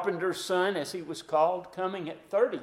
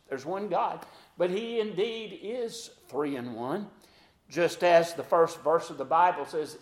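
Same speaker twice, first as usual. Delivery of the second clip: ordinary talk, nothing marked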